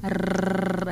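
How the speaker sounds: rolled tongue vibration, soft and low; tongue trill. Shown as a demonstration.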